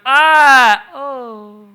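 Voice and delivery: high loud mock toddler gasp of wonder; a child suddenly distracted, imitated; toddler's surprised ah. Toddler spotting the balloon, high surprised gasp. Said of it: "He went".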